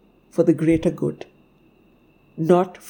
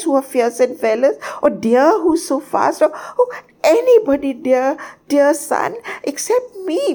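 high, squeaky bird in a flustered panic; hysterical outburst; 11 semitones higher than usual. A narrator's reading. Tiny bird begs sun to choose anyone else, hysterical. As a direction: protest frantically, stammering from one name to the next. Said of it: "my hysterical pow-, outburst".

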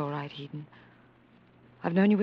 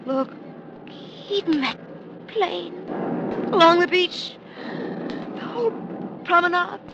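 higher, horrified, slowing; higher, horror-struck; 8 semitones higher, slower than usual. Acted. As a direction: look on in horror. Horror watching beach attack.

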